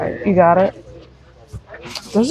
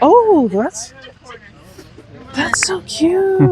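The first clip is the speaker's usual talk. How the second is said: sympathetic aww; 8 semitones higher, slower than usual. Real, not acted. Spotting cute item at yard sale.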